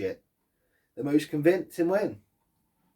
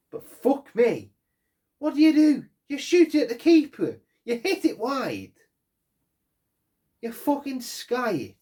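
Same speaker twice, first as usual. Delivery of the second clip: louder, higher, slowed exasperated outburst; exasperated rant; 9 semitones higher, 5 dB louder than usual. Real, not acted. Fan to his team about missed chances, furious outburst. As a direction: vent at the players' wasted chances.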